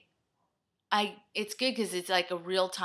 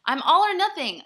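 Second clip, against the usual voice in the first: higher and much louder, near a yell; a real overwhelmed outburst; frantic exasperation; 9 semitones higher, 14 dB louder than usual. Real, not acted. Vlogger panicking about a seventeen-minute video, frantic and loud.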